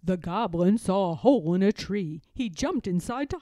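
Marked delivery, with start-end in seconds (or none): none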